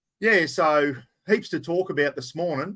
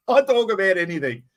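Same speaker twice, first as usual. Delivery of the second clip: said through a real laugh, a bit higher; 4 semitones higher, 4 dB louder than usual. Real, not acted. Host to stream viewers, laughing at himself. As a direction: chuckle the words out as you say them. Repeats line, second with laugh.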